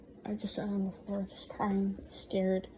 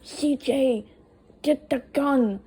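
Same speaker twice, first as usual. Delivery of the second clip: raised, louder imitation of a yelled order; imitated scream; 5 semitones higher, 9 dB louder, slower than usual. Friend calls to brother mid-fight, imitated scream. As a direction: call it out urgently, higher and louder, as a retelling.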